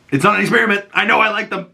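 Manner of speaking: high, firm mock teen; defiant Ellie. Ellie correcting Joel, high defiant insistence. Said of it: "But Ellie says to him".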